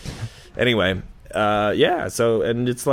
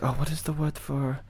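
mock French accent; 3 semitones higher, 9 dB quieter than usual. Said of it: "it's just like".

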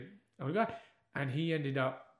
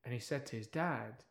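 plain reading voice

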